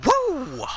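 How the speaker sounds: high ringing woo as a signature cry; a loud, high Nature Boy woo; Flair woo. Acted. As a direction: throw in a sharp falsetto woo. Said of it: "Nature Boy's spiritual guidance here".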